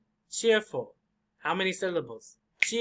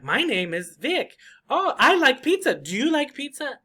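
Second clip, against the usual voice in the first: high, bouncy self-introduction; outgoing chatterer; 7 semitones higher, 4 dB louder than usual. Acted. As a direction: introduce yourself eagerly, pitch leaping up.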